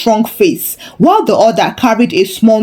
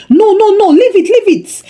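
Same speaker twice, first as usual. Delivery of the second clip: high, louder panicked refusal; near-shout in fear; 11 semitones higher, 3 dB louder than usual. A narrator's reading. Landlord panicking as Korede lifts his generator, frightened near-shout. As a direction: blurt it out, scared, much higher. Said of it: "the landlord nearly shouted from fear".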